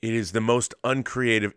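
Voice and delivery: normal talking voice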